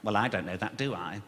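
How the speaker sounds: low-pitched officious doubt, lightly voiced; jobsworth parking officer. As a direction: lightly mimic a petty official stonewalling. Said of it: "walrus comes out with real jobs worths".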